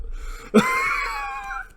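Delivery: very high squealing laugh; shrieking laugh. Real, not acted. Laughs at own slip.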